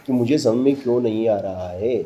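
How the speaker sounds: ordinary talk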